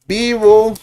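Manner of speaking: Vivo said high and loud; stressed model word. Shown as a demonstration. Teacher stressing Vivo for the class. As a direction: hit Vivo clearly for learners. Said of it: "teacher models answer".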